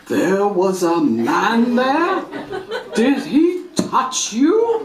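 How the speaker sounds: slow drawn-out worried question; mock alarmed mother. Mother horrified by the stranger, slow mock alarm. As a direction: stretch it in shock. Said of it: "mother alarmed".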